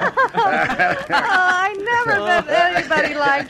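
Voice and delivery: words broken by little laughs; charmed and laughing as she talks; giggling. Acted.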